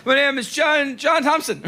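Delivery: high, over-innocent lie to the cop; lightly put-on innocent voice; fake innocent. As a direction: sell the fake name sweetly. Lying to a cop about name.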